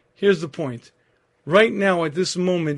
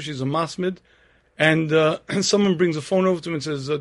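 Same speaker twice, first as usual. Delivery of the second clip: ordinary delivery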